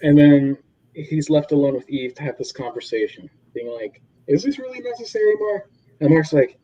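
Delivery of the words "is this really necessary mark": quick, higher mock Eve; lightly voiced worried girlfriend; voicing Eve.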